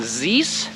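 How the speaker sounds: "zis" with a buzzing z, slowed; mock z for th. Shown as a demonstration. Teacher scolding learners, mock wrong. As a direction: drawl "zis" as the wrong version. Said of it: "if you're saying".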